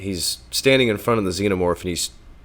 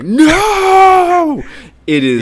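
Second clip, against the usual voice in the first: mock yell, much higher and louder; hammed-up anguished scream of no; impassioned no; 8 dB louder, much slower than usual. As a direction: bellow it with the jaw dropped low like a mummy. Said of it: "he gets his jaw low like the fucking mummy".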